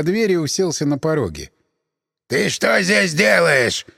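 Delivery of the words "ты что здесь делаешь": slow, threatening gruff peasant; menacing. A narrator's reading. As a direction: loom over him and demand it. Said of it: "грозно вопросил мужик".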